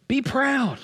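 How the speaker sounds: high, proud be proud; boastful world. Pretend.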